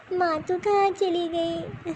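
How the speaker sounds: slow, tearful call to a lost mother; grieving daughter crying for her mother. Acted. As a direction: draw it out and break into sobs. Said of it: "तू रो रही है?".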